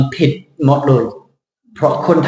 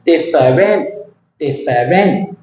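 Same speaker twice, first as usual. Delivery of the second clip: different as three full syllables, diff-er-REN; weight the last syllable of different; different, evenly spread, ending stretched; wrong Thai-style stress; slower than usual. Shown as a demonstration. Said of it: "Thai people".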